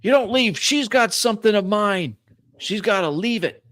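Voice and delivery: high, loud, insistent protest; shouted demand. Acted. Old man demanding his stolen property back, shouted demand. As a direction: shout to stop them leaving.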